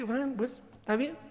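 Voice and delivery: quiet, careless shrug; dismissive dad. Retold or imitated. Father brushing off his hurt daughter, offhand. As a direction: wave it off softly.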